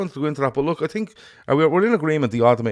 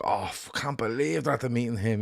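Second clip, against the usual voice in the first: lads' awed voice; 2 semitones higher, 6 dB quieter than usual. Retold or imitated. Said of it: "few of the lads were like".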